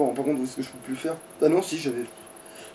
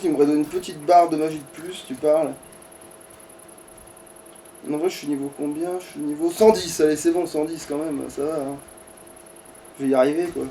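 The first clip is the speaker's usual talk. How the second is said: ordinary talk, nothing marked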